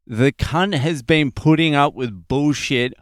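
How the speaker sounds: ordinary talk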